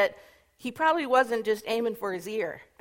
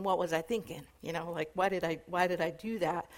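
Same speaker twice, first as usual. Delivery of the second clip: low, soft thinking aloud; rueful self-questioning; 6 semitones lower, 7 dB quieter, faster than usual. Acted. Peter looking back on his mistake, rueful self-questioning. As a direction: mutter it to yourself, pitched down. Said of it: "looking back on it and thinking".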